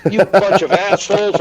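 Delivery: loud, high mock berating; jokey chewing-out; retold hard time. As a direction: holler it at the whole squad. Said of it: "I start giving everyone a hard time".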